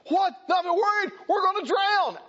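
high, loud frantic disciples; panicked cry. Acted. Disciples fearing the storm, panicked high cry. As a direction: cry out in fear of drowning.

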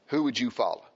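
normal talking voice

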